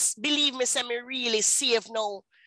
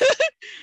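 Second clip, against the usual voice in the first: mocking laugh; 4 dB louder than usual. Ex mocks her choice.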